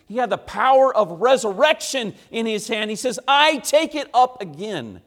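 loud, high-pitched preacher's proclamation; full-force preaching. Acted. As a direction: proclaim the scripture loudly, leaning hard on key words.

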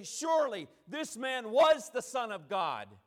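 loud, emphatic proclamation; acted shout of conviction; awed declaration. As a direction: declare it as a stunned witness. Voicing witnesses' declaration at the cross.

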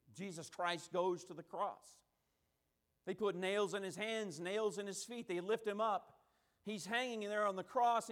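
ordinary talk, nothing marked

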